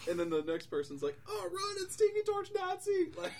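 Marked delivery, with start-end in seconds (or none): 1.3-3.1 high, loud cry of alarm; hammed-up terrified townsperson; mock panic. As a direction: shriek a pretend warning. Next person flees the monster.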